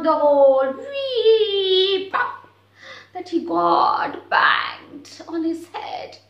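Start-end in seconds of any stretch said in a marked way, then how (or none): 0.8-2.4 high loud "wee" then pop; falling whoosh. Acted. Falling down the hole. 3.1-6.2 slow, crying shout on head bang; mock cry. A narrator's reading. Husky bangs head.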